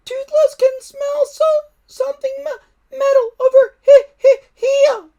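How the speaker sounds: very high, loud squeaky dragon call, stammering; little dragon calling. A narrator's reading. Toothless to the boys, calling high and squeaky. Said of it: "calling out".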